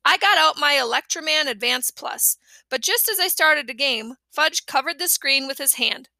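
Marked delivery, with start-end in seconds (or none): none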